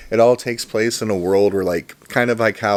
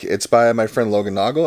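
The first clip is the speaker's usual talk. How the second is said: ordinary delivery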